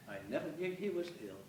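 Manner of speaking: usual speaking voice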